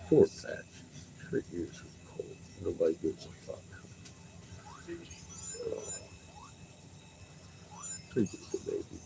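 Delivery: hushed whisper to the dogs. Real, not acted. Owner whispering over the dogs in her lap, hushed. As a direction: whisper softly so as not to stir them.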